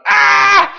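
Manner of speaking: high loud mock yelp; retold yelp of pain. Preacher retelling the horse stepping on him, high mock yelp. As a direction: yelp as the horse steps on you. Me going.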